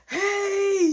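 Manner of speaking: high-pitched drawn-out hey; mock greeting. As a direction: call out a hello way up high. Acting out Devon's greeting.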